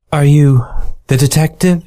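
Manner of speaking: a mysterious client at the door; femme fatale. Acted. The mink woman asks.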